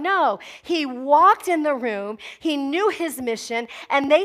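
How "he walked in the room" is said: usual speaking voice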